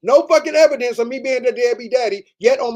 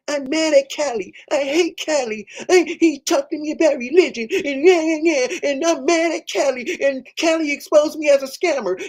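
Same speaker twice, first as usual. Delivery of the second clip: mocking the rival; 5 semitones higher than usual. Mocking his rival to viewers in a high whiny voice. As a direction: whine it out as the sore loser, pitched up. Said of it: "They're mad".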